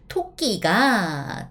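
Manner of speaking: ordinary talk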